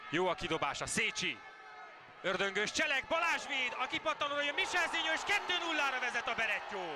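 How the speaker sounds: loud, fast goal call; excited Hungarian sports commentary. Acted. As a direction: shout the goal like a live commentator.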